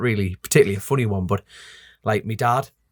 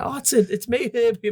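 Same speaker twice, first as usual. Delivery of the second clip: mum's high pleading; 12 semitones higher than usual. Retold or imitated. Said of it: "I'm going".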